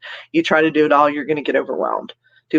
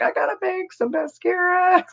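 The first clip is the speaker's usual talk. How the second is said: much higher, put-on old lady voice; old lady at seventy. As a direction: play yourself as a fussy old woman. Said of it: "I'll be, like, seventy years old".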